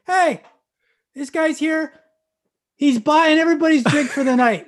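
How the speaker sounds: loud, high call across the bar; bartender's announcement. Retold or imitated. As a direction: shout it out to stop the whole room. Bartender announces round to whole bar.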